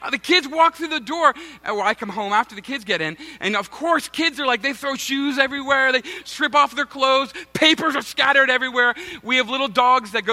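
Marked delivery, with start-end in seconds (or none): none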